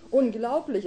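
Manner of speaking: voiced indignation of an older woman; indignant. A narrator's reading. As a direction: exclaim it, scandalised. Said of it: "empörte sich nun die Ältere".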